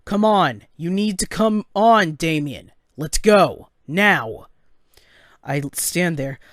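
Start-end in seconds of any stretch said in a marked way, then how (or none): 0.1-4.5 loud, raised-pitch fatherly urging; urgent command. Acted. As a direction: push him to get moving right now. Father urges breathless son onward.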